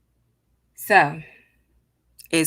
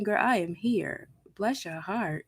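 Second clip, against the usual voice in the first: usual speaking voice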